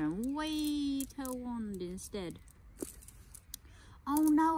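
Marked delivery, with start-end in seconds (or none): 4.1-4.6 higher and louder mock alarm; a hammed-up little panic; nervous gulp. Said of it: "I gulped".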